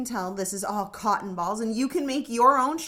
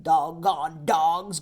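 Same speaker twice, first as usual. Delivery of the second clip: much lower, slow grumble; grumbling; 7 semitones lower, slower than usual. Acted. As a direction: grumble it under your breath. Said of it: "grumbled Bungo".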